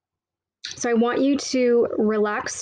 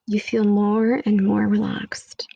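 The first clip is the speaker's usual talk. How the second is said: whisper. Acted. Whispered meditation line.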